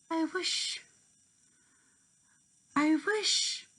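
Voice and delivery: high, hopeful fairy voice; Tink's voice. Acted. Tink making a wish, high hopeful voice.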